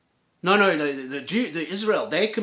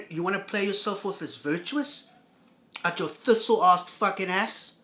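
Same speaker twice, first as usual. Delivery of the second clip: ordinary talk, nothing marked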